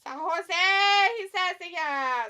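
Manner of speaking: loud, high whoop falling away; drawn-out cheer. Real, not acted. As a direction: call it out like a crowd greeting. Calls out a chat message loudly.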